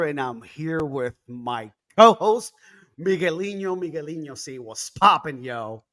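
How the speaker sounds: ordinary talk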